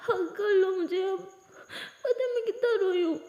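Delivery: giggle-sob while asking for a hug; laughing through tears. Acted. Maya laughing through tears asking Aliya for a hug. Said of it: "माया बोलती".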